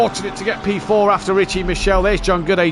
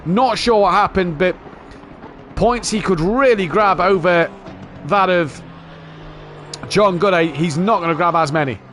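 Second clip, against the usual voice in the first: raised, lively race call; motorsport commentator; 4 semitones higher than usual. Real, not acted. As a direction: keep the live call going. Live race commentary continues.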